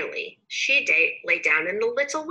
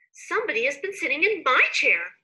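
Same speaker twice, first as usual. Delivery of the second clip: higher mama bear voice; 7 semitones higher, 4 dB louder than usual. A narrator's reading. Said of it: "said Mama Bear".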